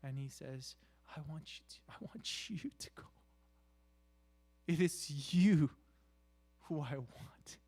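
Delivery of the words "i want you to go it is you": usual speaking voice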